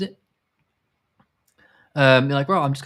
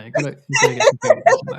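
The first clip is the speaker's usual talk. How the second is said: laughing through the words, fast and high; 8 dB louder, much faster than usual. Real, not acted. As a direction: giggle it out while talking. Joking about always eating.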